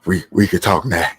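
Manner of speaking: low put-on caller voice; phone voice.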